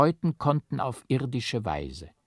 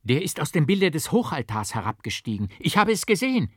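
high, louder excited telling; excited witness; 6 semitones higher, 5 dB louder, faster than usual. A narrator's reading. A witness tells the crowd what he saw, excited and high. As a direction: point it out breathless with awe.

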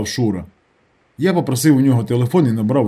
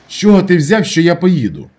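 higher, louder indignant question; offended; 6 semitones higher, 7 dB louder than usual. A narrator's reading. Narrator answering doubts that he will stay, offended. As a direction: bristle with hurt indignation. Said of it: "образився я".

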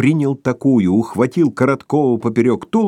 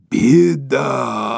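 roar; 3 semitones higher than usual. A narrator's reading. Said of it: "проревел".

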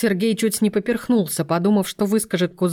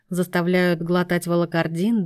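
ordinary delivery

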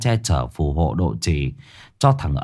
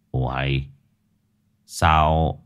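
put-on an old man puzzling to himself; puzzled old man; 7 semitones lower than usual. A narrator's reading. (giọng ông lão trầm, nhỏ, thắc mắc)

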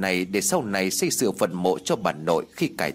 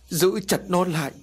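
high wailing cry of a grieving mother; anguished cry; 7 semitones higher than usual. A narrator's reading. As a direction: cry out in pain for her son. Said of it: "bà đau đớn nói". (giọng khóc than đau đớn)

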